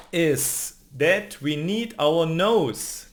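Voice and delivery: normal talking voice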